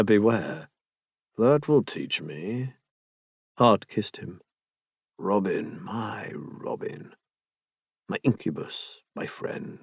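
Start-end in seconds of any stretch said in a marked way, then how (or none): 5.2-9.8 slow, whispered endearments; tender whisper. Acted. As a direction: breathe the pet names into his ear. Tender endearments after kiss.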